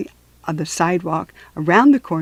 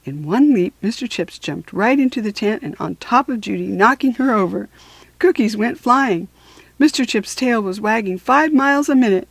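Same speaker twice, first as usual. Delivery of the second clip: plain reading voice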